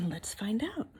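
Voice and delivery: suspenseful whisper to the listeners; hushed storyteller tease. Real, not acted. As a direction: lean in and whisper. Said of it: "storyteller whispers suspense".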